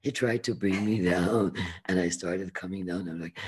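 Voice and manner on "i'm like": ordinary delivery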